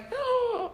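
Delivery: a high, sharp gasp of surprise; shocked gasp. Retold or imitated. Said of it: "they're like".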